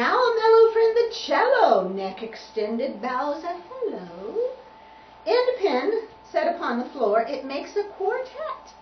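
high, lilting picture-book verse; poem reading. A narrator's reading. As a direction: open big, then lilt through the rhyme. Reads rhyming picture book.

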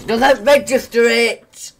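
loud, high-pitched complaint; exasperated rant. Real, not acted. Gamer complains about broken keyboard, exasperated yell. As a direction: raise your voice in exasperation.

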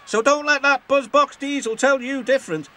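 high, fast, swaggering taunt; scornful boast. Acted. James mocks Duck about bees, boastful. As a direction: brag down at the small engine.

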